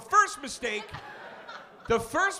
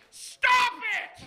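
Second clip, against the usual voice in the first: high, loud disbelieving cry; mock outraged yell; 13 semitones higher, 3 dB louder than usual. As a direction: shout it like he's gone too far. Outraged at extra food.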